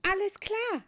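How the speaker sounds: high, loud eager reply; beaming. Acted. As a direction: burst with joy. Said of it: "strahlt der Geheimengel".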